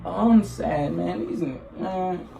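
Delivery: mock-sad, trailing down quietly; sad demo. Shown as a demonstration. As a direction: let it fade away as if downcast. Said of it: "hear the fucking emotion in your voice".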